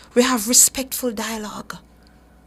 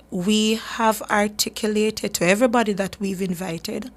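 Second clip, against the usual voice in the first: ordinary delivery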